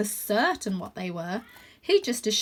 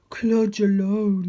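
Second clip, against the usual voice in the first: slow, louder lonely dog voice; sniffing big dog; 6 dB louder, much slower than usual. A narrator's reading. Lonely dog telling the cat he has no friends, slow. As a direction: sniff it out, slow and sorry for himself. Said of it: "he sniffed".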